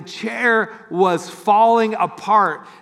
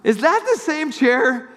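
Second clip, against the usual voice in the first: high, amazed question; surprised; 8 semitones higher than usual. Retold or imitated. John recognizing the chair, high and amazed. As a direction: repeat the astonished question. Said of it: "And it looks something like this".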